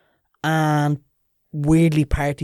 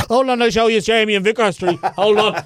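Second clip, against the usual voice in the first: higher, excited show-off dad; imitating dad; 6 semitones higher than usual. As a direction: play the proud dad stopping the room to show a video. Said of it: "My dad says".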